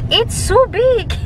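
high, drawn-out amazement; amazed. Retold or imitated. Wife telling viewers about her huge plate of ribs, amazed. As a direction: exclaim it high and slow, about to laugh.

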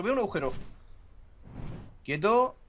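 ordinary delivery

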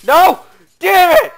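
high, very loud cursing; frustrated shout. Real, not acted. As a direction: shout it at full volume in frustration.

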